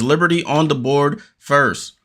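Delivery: quick running commentary, rising on the action; live play-by-play. Acted. Fan calling the Liberty game live for viewers, quick play-by-play. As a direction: call each pass and shot as it happens.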